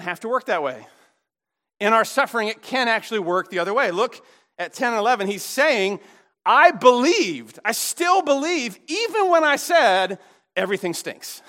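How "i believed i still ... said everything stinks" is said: loud, high, slow insistence; emphatic declaration. Acted. Preacher voices the psalmist still believing, emphatic declaration. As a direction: declare it slowly, pitched up and forceful. Voicing the psalmist's stubborn faith.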